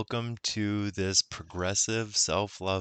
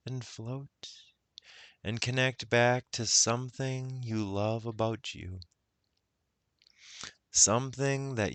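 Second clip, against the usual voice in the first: unhurried guided meditation voice; 2 semitones higher than usual. Acted. Guide leading listeners into self-love, guided meditation. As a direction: ease the listener inward, with long pauses.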